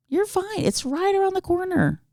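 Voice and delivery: high, soft mimicked voice; high-pitched mocking soothing; mock reassurance. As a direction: coo it back in a tiny high voice. Mockingly replays his reassurance.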